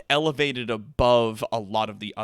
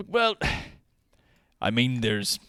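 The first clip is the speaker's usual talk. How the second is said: nervous Shaw; 5 semitones lower, 4 dB quieter than usual. Acted. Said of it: "Shaw, in this moment, appears nervous".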